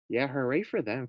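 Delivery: ordinary delivery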